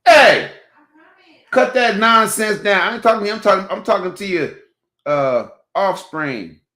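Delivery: sudden shout that fades by the last word; real shout. Real, not acted. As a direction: bark to cut the nonsense down. Yelling at someone off mic.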